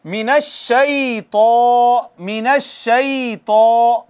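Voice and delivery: मिनश्शैतो with a heavy, thick त and a long आ; slow recitation of मिनश्शैतो, thick and drawn out; heavy recited shaitan. Shown as a demonstration. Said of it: "इसको मोटा पढ़ेंगे".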